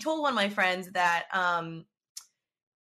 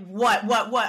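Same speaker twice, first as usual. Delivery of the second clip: retold urgent demand; 7 dB louder, slower than usual.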